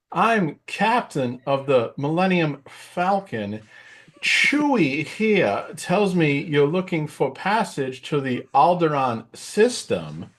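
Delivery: high, very slow, oddly measured Walken-style drawl; Christopher Walken impression. Spacey's Walken auditioning as Han Solo, slow and high. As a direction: stretch each phrase out slowly in a Walken cadence. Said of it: "his Christopher Walken was pretty hilarious".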